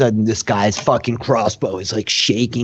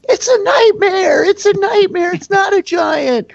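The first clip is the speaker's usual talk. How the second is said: very high, wailing scream; panicked shriek; 4 dB louder than usual. Acted. Terrified foe cries out after lightning, panicked shriek. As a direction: shriek it in hysterical terror.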